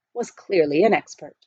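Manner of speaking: usual speaking voice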